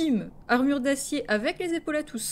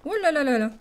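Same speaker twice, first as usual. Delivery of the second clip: excited oh là là; 3 semitones higher, 6 dB louder, faster than usual. Real, not acted. Tempted by a fake roll.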